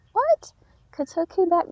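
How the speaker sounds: high, disbelieving squeak; surprised. Acted. Gardener to viewers about her returning plant, high mock surprise. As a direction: act stunned the plant is back.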